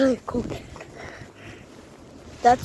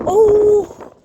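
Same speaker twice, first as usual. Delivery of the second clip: surprised shout; 6 semitones higher, 11 dB louder than usual. Real, not acted. Caught in blizzard wind.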